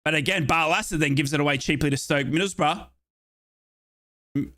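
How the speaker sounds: ordinary delivery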